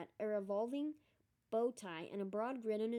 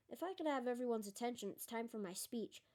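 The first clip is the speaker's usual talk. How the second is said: ordinary delivery, nothing marked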